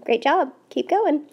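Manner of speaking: ordinary talk, nothing marked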